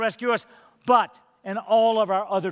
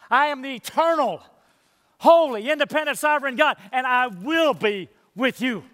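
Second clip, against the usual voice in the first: higher, each title rung out from the pulpit; preaching at full force; 3 semitones higher than usual. Acted. Preacher to his church, rising force on God's titles. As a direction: proclaim each name of God like a herald.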